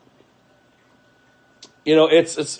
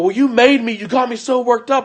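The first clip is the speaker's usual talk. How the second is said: high mock whine; mocking the wife; 11 semitones higher, 7 dB louder than usual. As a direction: mimic her excuse in a high voice. Mocking wife's excuse.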